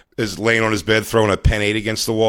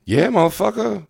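mock exclamation; 11 semitones higher than usual. Riffing, acted exclamation.

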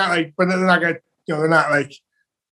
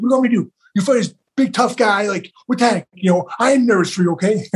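flustered coach; 5 semitones higher than usual. Retold or imitated. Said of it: "He's like".

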